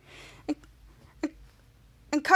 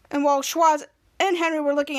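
usual speaking voice

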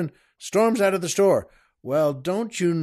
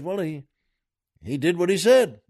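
ordinary talk

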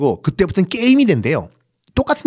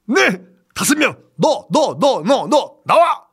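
soldier barking orders; 10 semitones higher, slower than usual. Acted. Soldier picks the prisoners.

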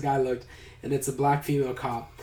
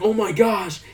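higher-pitched retold exclamation; mock dismay; 6 semitones higher, 3 dB louder, slower than usual. Derry finding his underwear strewn outside, mock dismay. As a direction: play up the dismayed reaction.

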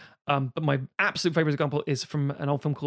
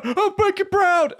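lightly hammed teacher, building to a high shout; rising mock shout; 7 semitones higher, 5 dB louder than usual. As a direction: start earnest, then climb up into a shout. Teacher consoling crying kid.

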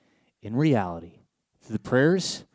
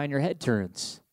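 ordinary talk

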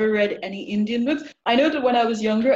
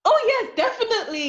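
high, laughing yes; much higher, delighted and laughing; excited yes; 12 semitones higher than usual. Real, not acted. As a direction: gush agreement, laughing.

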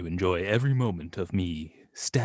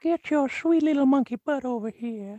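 higher, odd creepy voice; Family Guy creep. Pretend. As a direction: coax it like a creepy old man. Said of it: "Family Guy series".

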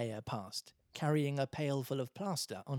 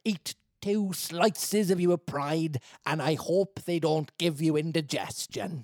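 higher, loud character voice; story character; 3 semitones higher, 6 dB louder, slower than usual. Acted. Character refusing a hungry beggar, high loud voice. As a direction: deliver a slow, pointed retort in character.

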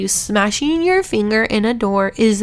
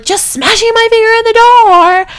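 retold frustrated scream; 13 semitones higher, 9 dB louder than usual. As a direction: re-enact screaming at your husband in pain. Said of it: "screamed at him and was like".

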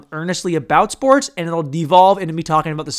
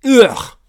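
disgusted groan; 7 semitones higher, 9 dB louder than usual. Real, not acted. Disgusted at own joke.

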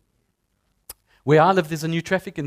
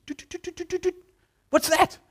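mouse scurrying noise; 12 semitones higher than usual. Retold or imitated. Mimicking scurrying mice.

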